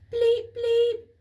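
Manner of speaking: two loud high bleeps; loud high mock keypad beeps; double beep. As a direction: beep twice like a cheerful keypad. Keypad beeps green light.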